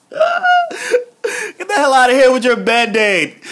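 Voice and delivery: laughing disgust at the Band-Aid; laughing mock outrage. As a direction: shove it away while laughing. [name]'s disgusted reaction, laughing.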